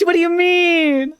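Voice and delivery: very high, slow squeal; mock offended. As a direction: squeak it in fake outrage.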